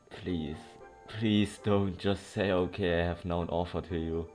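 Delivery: ordinary talk, nothing marked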